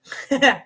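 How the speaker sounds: real brief chuckle; short laugh. Real, not acted. Amused at own remark.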